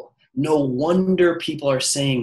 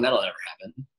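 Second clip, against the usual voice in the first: doubting inner voice; 7 semitones lower, 6 dB quieter, faster than usual. Retold or imitated. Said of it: "in your mind you're going".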